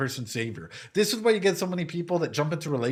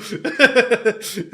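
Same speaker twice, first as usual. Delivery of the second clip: high loud laugh through tears; laughing to cope with crying; teary laugh; 8 semitones higher, 7 dB louder than usual. Real, not acted. Streamer moved by the anime, teary laugh.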